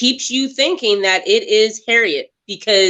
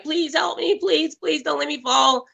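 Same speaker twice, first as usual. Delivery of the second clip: higher, softer begging voice; a hint of a frightened woman pleading; mock pleading; 7 semitones higher, 5 dB quieter than usual. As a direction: beg to be pulled up, pitched up and hushed. Rose dangling, begging for help.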